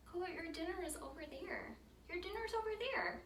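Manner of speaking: doggy talk. Real, not acted. Owner sweet-talking her dog.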